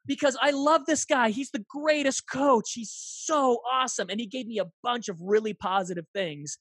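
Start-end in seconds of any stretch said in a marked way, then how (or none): 0.1-4.1 enthusiastic. Real, not acted. Enthusing about his coach.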